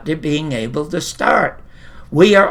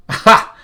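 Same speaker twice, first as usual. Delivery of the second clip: a loud, breathy burst of a laugh; a sudden realisation barked out; sharp stage laugh; 6 dB louder than usual. Shown as a demonstration. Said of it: "And here it is".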